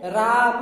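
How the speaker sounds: "राम" drawn out, then a short mah ending; visarga pronunciation. Shown as a demonstration. Teacher reading the word with final visarga, pronounced demonstration. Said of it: "इसको हम पढ़ेंगे".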